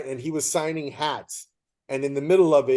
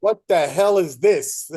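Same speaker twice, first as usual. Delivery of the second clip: slow, higher and louder, mock disbelief; retold outraged outburst; 5 semitones higher, 6 dB louder, slower than usual. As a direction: play the fan who just found a Yankees ball. Friend finds Yankees ball in box.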